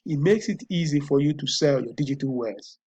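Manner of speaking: ordinary talk, nothing marked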